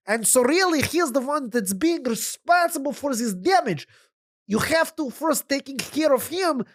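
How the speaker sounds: panicked wagon driver. Acted. Wagon driver pleads for his life.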